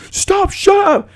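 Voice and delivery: very high, drawn-out shriek; mock-panicked falsetto plea for silence; imitated freak-out. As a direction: squeal it in exaggerated alarm. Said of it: "They're all freaking out".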